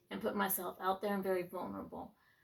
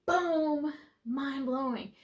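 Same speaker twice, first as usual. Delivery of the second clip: high, loud burst of amazement; excited exclamation; 5 semitones higher, 6 dB louder, much slower than usual. Real, not acted. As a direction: shout it like a revelation. Mind-blown exclamation.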